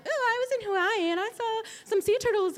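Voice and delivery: lightly voiced breezy holiday chatter; chatty patient. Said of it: "'cause she's like".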